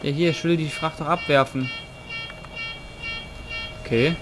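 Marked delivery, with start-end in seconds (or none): none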